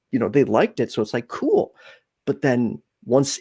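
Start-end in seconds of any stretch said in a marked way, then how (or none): 1.3-1.8 mock cool. Imitating approving crowd.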